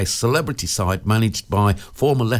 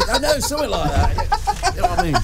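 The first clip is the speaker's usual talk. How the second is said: laughing. Real, not acted. Laughing at own kid impression.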